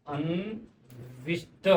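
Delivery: अन्विष्ट drawn out very slowly and softly; soft, very slow अन्विष्ट, ending on the retroflex ष्ट; slow correct word. Shown as a demonstration. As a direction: stretch out अन्विष्ट syllable by syllable. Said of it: "इसका शुद्ध स्वरूप होगा".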